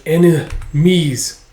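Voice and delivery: slow, broken-up "enemies"; drawn-out word. Acted. Trapped dragon thinks of surrounding foes, drawn out. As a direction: stretch "enemies" out, syllable by syllable.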